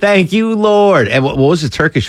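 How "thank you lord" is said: higher, hammed-up cry of relief; mock praise. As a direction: squeal a thankful prayer in mock joy. Hams up staff's relief.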